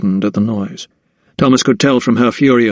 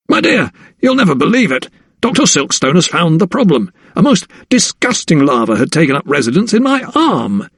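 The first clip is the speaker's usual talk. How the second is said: a man almost gleeful over his own larva; 6 semitones higher than usual. A narrator's reading. Husband telling his wife the news, gleeful. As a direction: relish the gross news, bright and high. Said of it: "He spoke almost gleefully".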